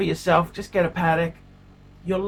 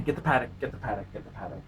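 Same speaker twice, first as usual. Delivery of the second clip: fading repetition; 6 semitones lower, 8 dB quieter, much faster than usual. Acted. Repeated mantra fading out.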